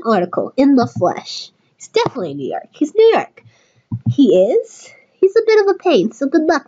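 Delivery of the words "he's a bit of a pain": ordinary talk, nothing marked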